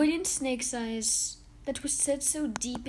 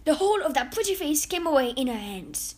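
plain reading voice

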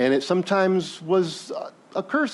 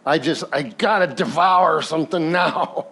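a hint of ravenous gulping; mock-greedy craving, lightly voiced; gulping hungry Esau. Preacher voicing Esau desperate for food, mild mock hunger. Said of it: "it's like a gulping sound".

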